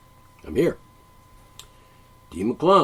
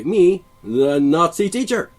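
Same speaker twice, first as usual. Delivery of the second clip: loud, domineering self-announcement; mock tyrant teacher; 7 semitones higher, 7 dB louder, slower than usual. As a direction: proclaim yourself the classroom tyrant.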